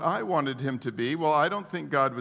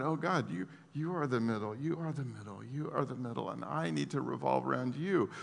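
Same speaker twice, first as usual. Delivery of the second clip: hushed prayer; 4 semitones lower, 7 dB quieter than usual. Acted. Voiced prayer, hushed and repeated.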